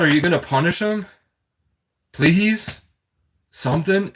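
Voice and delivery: lightly pleading, pitch lifted; retold pleading. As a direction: act out begging God to step in. Acting out own plea to God.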